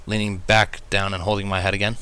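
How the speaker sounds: ordinary delivery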